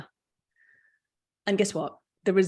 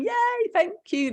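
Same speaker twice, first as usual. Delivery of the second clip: bright, much higher, slowed yay of delight; delighted thanks; 4 dB louder than usual. Real, not acted. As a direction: thank her with real delight. Thanking audience member for feedback.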